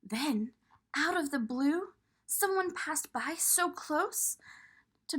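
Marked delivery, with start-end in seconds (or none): none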